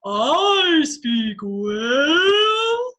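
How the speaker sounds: slow, stretched whale-speak, loud; dark, dragged-out whale voice; Dory speaking whale. Shown as a demonstration. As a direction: draw every word out like a whale call. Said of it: "Everyone do it with me".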